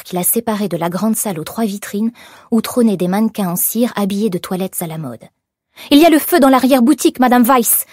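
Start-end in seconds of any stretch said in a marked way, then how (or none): 5.9-7.9 fast, high, loud cry of alarm; alarmed shout. A narrator's reading. Employee alerting her boss to the fire, urgent shout. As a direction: shout the news urgently, racing through it. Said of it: "cria-t-elle".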